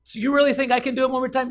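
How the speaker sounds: high, loud, rapid question; rushed, hyped-up asking; excited challenge. Acted. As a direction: egg him on quickly. Acrobat egging on the Duke.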